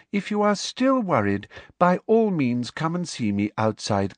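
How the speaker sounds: high, measured, emphatic lecture; prim, sweetly authoritarian professor. A narrator's reading. Professor denying the danger to her class, sweetly authoritarian. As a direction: lecture with sugary firmness, stretching key words.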